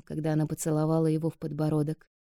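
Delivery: plain reading voice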